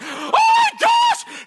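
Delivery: high, drawn-out panicked shout; acted-out shriek of shock. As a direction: cry out in alarm. Son reacting to mother's outburst.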